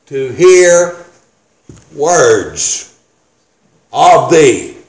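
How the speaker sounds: "to hear", "words", "of thee" each set apart by long pauses; weighty, separate phrases, "words" dropped low; emphatic pauses. Shown as a demonstration. Preacher pointing congregation to the key word, "words" isolated by pauses.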